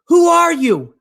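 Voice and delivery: high, loud call up to the clouds; acted calling out. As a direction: shout it upward as the startled hiker. Dangling hiker calls up to voice.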